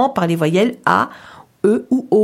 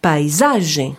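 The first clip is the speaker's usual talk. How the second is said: "paisagem" with the weight on SA, said zage; stress on ZAGE; 5 semitones lower, slower than usual. Shown as a demonstration. Teacher repeats the example to confirm, stress on second-last syllable. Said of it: "on entend bien sur le zage".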